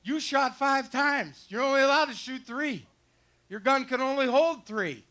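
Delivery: a stern game warden, mostly in his own voice. Retold or imitated. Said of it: "He's going".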